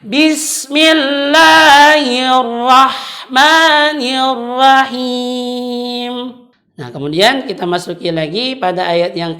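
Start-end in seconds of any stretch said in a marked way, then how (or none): 0.0-6.1 slow melodic Quran recitation; correct recitation. Shown as a demonstration. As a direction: chant it slowly and clearly. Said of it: "Bisa diikuti dari rumah". (bacaan tartil yang benar)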